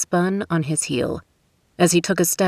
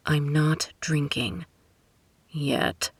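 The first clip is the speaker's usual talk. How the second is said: muttering under breath; 5 dB quieter, slower than usual. A narrator's reading. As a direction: grumble it quietly to yourself. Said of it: "he muttered under his breath".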